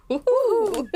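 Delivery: high whooping cheer; a giddy whoop; woohoo. Real, not acted. Cheering at the mention of Loch Ness, high whoop.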